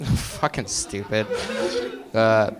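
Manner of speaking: usual speaking voice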